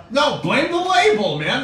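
loud, high, stretched-out plea; playful self-defending holler; mock protest. Real, not acted. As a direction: shift the blame at full volume. Mock self-defence amid laughter.

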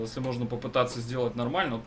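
ordinary talk

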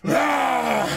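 high, loud hype yell; excited shout. Real, not acted. Reacting to Guts charging, a loud excited shout. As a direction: yell out in thrill.

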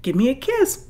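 lightly voiced daughter coaxing a calf; coaxing child. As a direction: coax the calf like a little girl. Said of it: "my little nine-year-old bends down and goes".

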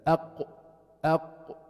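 أق ending in a sharp, complete stop; شدة: أق بقطع الصوت; cut-off qaf. Shown as a demonstration. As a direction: clip أق off hard so no sound flows. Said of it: "there is discontinuation of the sound".

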